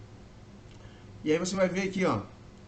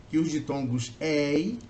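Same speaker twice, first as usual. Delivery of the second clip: slow emphasis; slower than usual. Shown as a demonstration. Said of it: "teacher stressing the rule".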